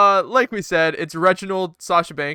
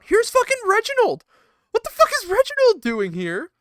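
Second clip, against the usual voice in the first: much higher, incredulous; exasperated disbelief; 4 dB louder, faster than usual. Real, not acted. Ranting to his co-host about Reginald showing up, incredulous. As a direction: throw your hands up in disbelief.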